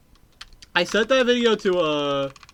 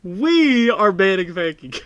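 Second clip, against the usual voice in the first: loud, slow mock-solemn pronouncement; mock decree; 2 semitones higher, 9 dB louder, slower than usual. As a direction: proclaim the ban like a judge.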